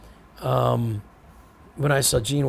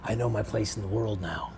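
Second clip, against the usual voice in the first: hushed, serene, much softer voice; a calm guru talking softly; soft-spoken Seagal; 5 semitones lower, 8 dB quieter than usual. Retold or imitated. Seagal retold, soft spiritual.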